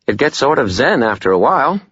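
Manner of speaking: cheerful, upbeat lilt; light and sunny delivery; bright. A narrator's reading. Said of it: "Butters said brightly".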